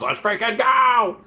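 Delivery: high, slow hype shout; hyped chat read. Pretend. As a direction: read it like a hyped fan screaming. Reading chat message in hype voice.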